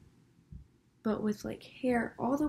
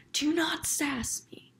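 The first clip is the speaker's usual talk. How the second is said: lady's sass warning; 7 semitones higher, slower than usual. Retold or imitated. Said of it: "I can't do the best voice".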